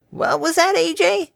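put-on high, cheery elderly mare; a sweet old farm granny offering water. A narrator's reading. Granny offering water to the workers, high and cheery.

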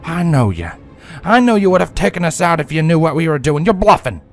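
loud, quick angry accusation; heated defiance. A narrator's reading. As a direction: throw it back at her fast. Applejack defying villain.

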